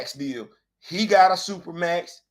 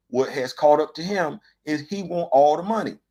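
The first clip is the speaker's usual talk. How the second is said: ordinary delivery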